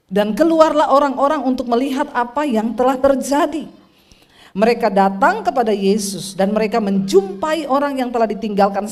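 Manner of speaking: loud preacher's proclamation to the congregation; preaching at full force. Acted. Preacher calling out to the congregation, loud and fervent. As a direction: proclaim it to the whole church.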